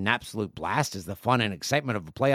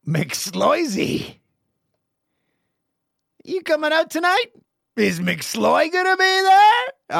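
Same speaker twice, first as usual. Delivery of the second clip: high excited hoser buddy call; 5 dB louder, much slower than usual. Pretend. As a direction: yell it like a rowdy pal. Calling out to a buddy.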